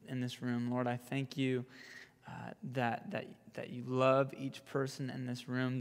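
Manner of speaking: soft, low, hushed praying; prayer. Real, not acted. Youth pastor prays for everyone in this room, soft prayer. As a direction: pray gently and sincerely, softly.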